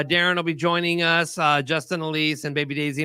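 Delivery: ordinary delivery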